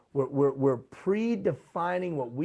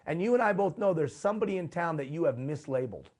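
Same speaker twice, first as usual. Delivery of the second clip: normal talking voice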